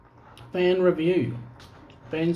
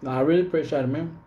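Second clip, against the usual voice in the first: ordinary talk, nothing marked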